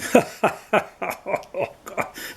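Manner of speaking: breathy, high burst of laughter; buffalo laughing. Acted. Buffalo laughs at the begging lion, burst of laughter. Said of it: "burst out laughing".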